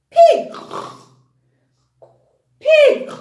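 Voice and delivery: "pig" called out high and loud; emphatic word. Shown as a demonstration. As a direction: shout the word so the kids say it back. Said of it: "Help me out".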